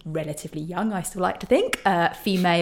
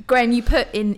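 ordinary talk, nothing marked

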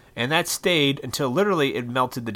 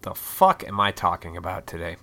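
usual speaking voice

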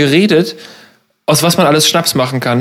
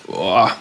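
low, soft strained boah; strained gasp; 8 semitones lower, 5 dB quieter than usual. Real, not acted. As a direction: react to the sourness. After tasting sour shot.

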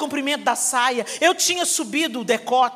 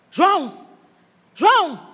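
loud call across the house; 5 semitones higher, 4 dB louder, much slower than usual. Retold or imitated. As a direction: call João from another room. Father calling son in house.

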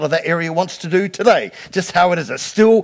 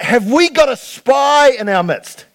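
alarmed shout; 6 semitones higher, slower than usual. Retold or imitated. King shouting at his officers, alarmed. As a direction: bellow it at the room. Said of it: "he's like".

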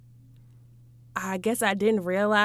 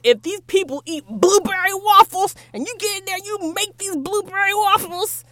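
mock angry threat; 8 semitones higher, 3 dB louder than usual. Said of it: "I was pissed".

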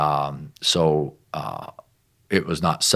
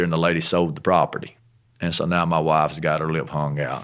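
ordinary delivery

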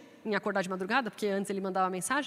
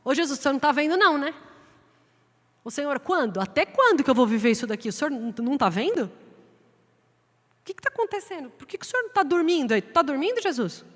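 complaining prayer; 4 semitones higher, 4 dB louder than usual. Acted. Believer complaining to Jesus.

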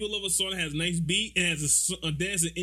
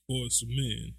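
normal talking voice

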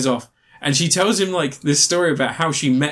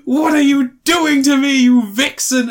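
higher and louder mock outcry; exasperated outburst; 9 semitones higher, 5 dB louder, slower than usual. The frustrated lover yelling at the woman who provoked him, a loud mock outcry. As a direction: throw up your hands and cry out at her. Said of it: "And Mark Ruffalo's like".

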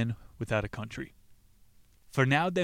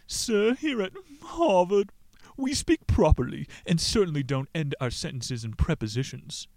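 snooty student voice; 6 semitones higher, 3 dB louder than usual. Acted. Snooty Harvard student in joke.

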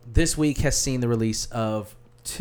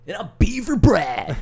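hammed-up announcer; 7 semitones higher, 5 dB louder than usual. Hammed-up character intro.

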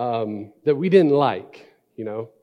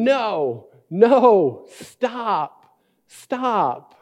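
put-on high wailing toddler cry of no, no, stop; imitating toddler screaming; 8 semitones higher, 5 dB louder, much slower than usual. Father imitating his two-year-old screaming at his brother, high and wailing. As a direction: play the two-year-old shrieking for help. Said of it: "And Caleb was screaming".

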